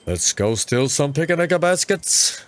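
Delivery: a poor Yogi Bear chasing picnic baskets. Retold or imitated. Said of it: "my worst, uh, Yogi Bear impersonation ever".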